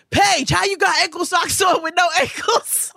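mocking boy. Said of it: "he was like".

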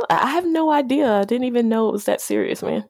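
ordinary talk, nothing marked

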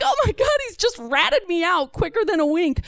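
high-pitched panicky disbelief; acted panic. Child just ratted out by Dad, panicking. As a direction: start very high, aghast at the betrayal.

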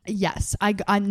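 ordinary talk

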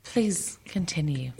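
slow, breathy mock whisper. Imitating Angelina whispering at the altar to go on. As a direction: whisper it like a prim bride. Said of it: "just like Emily and say".

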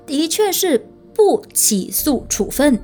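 的确是不起诉处分 said slowly, each word weighted; emphatic slow delivery. Shown as a demonstration. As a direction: lean on 的确 and slow right down. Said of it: "是的，你没听错，我也没有讲错".

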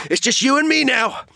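high, hammed-up climactic threat; mock dramatic showdown cry; action-movie showdown. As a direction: imitate a movie hero's final face-off. Acts out climactic movie showdown line.

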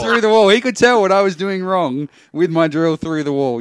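loud, high-pitched amazed retelling; excited shouting. Real, not acted. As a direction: raise your voice in comic disbelief. Excitedly explains the neighbour's yelling.

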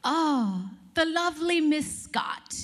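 slow, high, charmed welcome; hammed-up grand couturier; lightly voiced Oscar de la Renta; grand designer greeting. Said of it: "he's like".

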